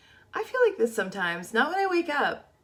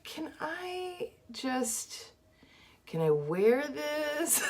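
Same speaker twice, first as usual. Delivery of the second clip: slow, higher-pitched, drawn-out question; mock-hesitant, slow and high; doubtful self-questioning; 9 semitones higher, 3 dB quieter, much slower than usual. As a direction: stretch it out as if unsure you dare ask. Acts out doubting her outfit.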